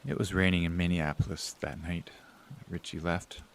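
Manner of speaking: whispered, low and soft; hushed monologue. Acted. Bill turning to the audience after his brother leaves, whispering. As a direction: confide it to the dark.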